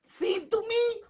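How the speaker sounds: high, loud pleading shout; desperate protest. Acted. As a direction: shout your complaint at God, near tears. Moshe pleading angrily with God.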